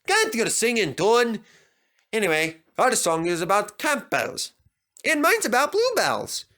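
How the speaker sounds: lightly voiced grumpy engine; a hint of a gruff Scottish engine; Douglas voice. Douglas grumbling back at Percy.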